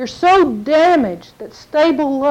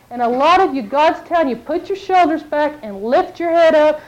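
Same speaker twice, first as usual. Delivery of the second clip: ordinary talk, nothing marked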